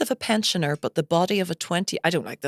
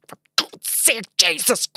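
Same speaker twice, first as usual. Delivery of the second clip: slow, lightly voiced mum giving off; retold grumbling; 4 semitones higher, slower than usual. Mum stuck in traffic, mildly giving off. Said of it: "just giving off nonstop".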